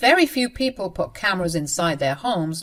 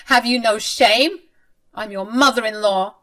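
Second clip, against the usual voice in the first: higher, louder scolding mother-in-law; indignant mother-in-law; 4 semitones higher, 7 dB louder than usual. Acted. Mother-in-law scolding her daughter-in-law, high and indignant. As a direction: play the outraged mother-in-law.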